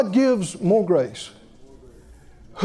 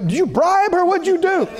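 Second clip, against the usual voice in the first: higher, mock-accusing questions; pitched-up retold accusation; imitating indignant passengers; 7 semitones higher than usual. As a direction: act out suspicious, put-out onlookers. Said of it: "people were sweating and mad".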